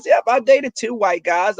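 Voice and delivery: normal talking voice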